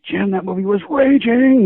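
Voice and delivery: high, slow, gushing praise; mock excited gush. Calling the director to praise his film, high gushing. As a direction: gush at him like a thrilled fan. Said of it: "I'm like".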